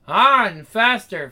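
slow, loud moan into the words; acted moan. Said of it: "her moans intensify".

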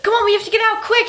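high, rushed panic imitation; panicked. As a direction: act out a hurried panic. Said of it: "He's, like, panicking".

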